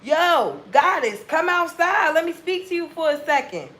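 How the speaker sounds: loud high catcall up to a window; mock street holler to a goddess; yelling from the window. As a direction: call her outside like a guy on the street. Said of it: "motherfuckers yelling from the window".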